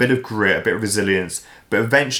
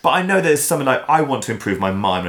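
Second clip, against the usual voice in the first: ordinary talk, nothing marked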